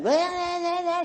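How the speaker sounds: high-pitched, drawn-out angry gibberish; mock raging. Raging red going off at a sister-in-law, mock raging. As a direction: rant in wordless, hammed-up rage. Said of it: "You can be a raging red".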